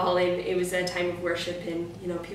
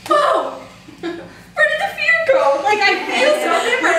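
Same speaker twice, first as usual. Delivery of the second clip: high, loud, laughing amazement; joyful amazed cry; 10 dB louder than usual. Retold or imitated. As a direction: burst out in laughing wonder. Joyful release when fear left.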